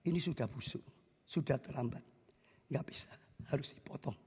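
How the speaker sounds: very soft, hushed bad news; grave doctor. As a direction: break the bad news almost in a whisper. Dokter menyampaikan kabar buruk. (dokter berbisik berat menyampaikan kabar buruk)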